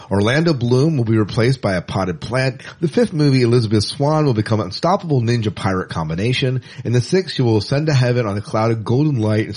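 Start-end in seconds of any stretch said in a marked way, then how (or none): none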